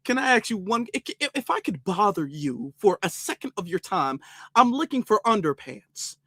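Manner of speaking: hammed-up courteous, careful speech; overly polite shopper. Shopper politely asking a Walmart worker for help, hammed-up proper voice. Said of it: "If I go into Walmart".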